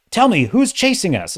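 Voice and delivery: mock an amateur writer's character; rookie dialogue. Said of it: "saying things like".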